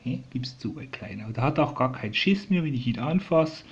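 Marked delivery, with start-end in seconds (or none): 0.3-1.3 softer, tender teasing to the bird; hushed little tease to a pet. Real, not acted. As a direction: coo it to him quietly.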